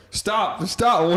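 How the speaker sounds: high, loud retold shout; loudspeaker warning. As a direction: imitate an alarmed order over a loudspeaker. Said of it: "over the loudspeaker".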